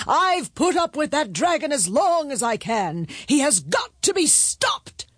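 high, emphatic royal proclamation; pompous, high-pitched king voice; indignant king. A narrator's reading. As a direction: declare it to the crowd as an exasperated king.

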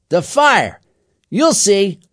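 loud, high gang-leader call; commanding. A narrator's reading. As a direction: call it out like a boss. Gang leader answers Aaron.

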